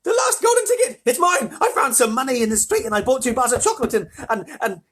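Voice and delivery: put-on high, loud, tumbling excited boy; excited outburst. A narrator's reading. Boy tells mother he found the ticket, wildly excited. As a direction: gush it all out, tripping over words.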